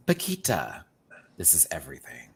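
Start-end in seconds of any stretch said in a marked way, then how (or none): 1.4-2.3 hushed fierce whisper. Real, not acted. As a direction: whisper it like a secret vow. Whispered fierce aside about look.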